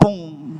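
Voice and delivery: soft mouth gunshot. Retold or imitated. Gunshot in Nagin story.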